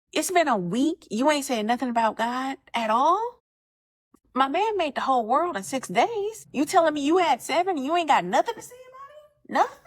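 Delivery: exasperated disbelief. Retold or imitated. Exasperated rant at the man.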